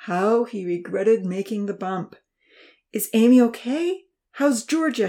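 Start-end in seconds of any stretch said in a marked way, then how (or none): 2.9-5.1 higher, louder worried questions; fretting anxiously. A narrator's reading. Professor asking after the girls, fretting. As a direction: fret over each girl. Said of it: "he fretted anxiously".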